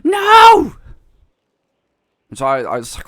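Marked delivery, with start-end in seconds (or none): 0.0-0.8 sudden piercing scream of no; a shrill, full-throated no. Retold or imitated. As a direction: scream it at the top of your lungs. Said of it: "she screamed at the top of her lungs".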